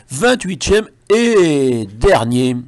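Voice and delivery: slow, loud mock-proud announcement; mock triumphant. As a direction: announce last place like a victory. Mock-proud last place.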